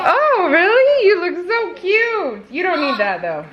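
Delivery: high, drawn-out sweet coo. Real, not acted. Cooing over child's outfit.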